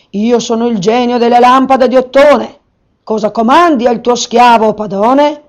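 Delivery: loud, formal genie announcement; a lightly voiced genie declaring himself; the genie greeting his new master; reciting genie. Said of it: "recitò il genio".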